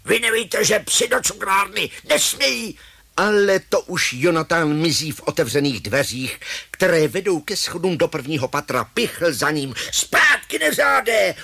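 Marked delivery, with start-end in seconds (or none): 0.1-2.8 high, scolding shout; angry yelling. Acted. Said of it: "Pichl začne křičet". 9.9-11.4 high, loud angry order; hollering. Acted. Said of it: "huláká".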